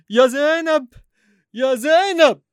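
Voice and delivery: loud high call for help; panicked shout. Acted. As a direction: yell for your wife in panic. Calling wife in panic.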